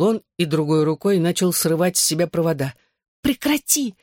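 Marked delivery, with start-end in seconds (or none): 3.2-3.9 high, urgent command to stop; sharp demand. A narrator's reading. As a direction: snap stop at him. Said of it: "потребовала я".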